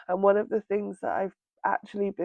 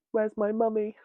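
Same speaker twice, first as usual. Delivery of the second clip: put-on small child's plea; 3 semitones higher than usual. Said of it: "and then I just said".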